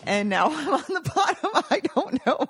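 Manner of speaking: laughing. Real, not acted. Laughing at own bad luck.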